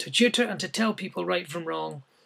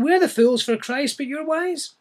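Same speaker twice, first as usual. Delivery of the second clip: higher, tongue-in-cheek jab at "wise"; gently mock the proud listeners, pitched up; a hint of sarcasm, higher on "wise"; slight sarcasm; 7 semitones higher, 6 dB louder than usual. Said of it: "maybe slight sarcasm".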